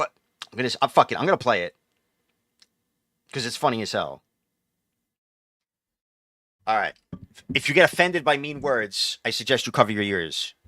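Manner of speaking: normal talking voice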